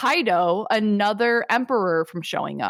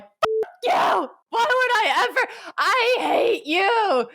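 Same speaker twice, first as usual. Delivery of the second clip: Buggy's tirade; 10 semitones higher than usual. Retold or imitated. Buggy refuses Shanks's request, in a high, hammed-up tirade. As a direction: shriek it like a hammed-up tantrum. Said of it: "in the middle of this tirade".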